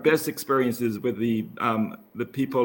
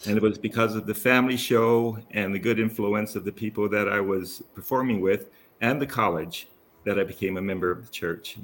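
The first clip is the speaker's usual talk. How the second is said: ordinary talk, nothing marked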